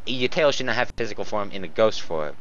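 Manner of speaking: normal talking voice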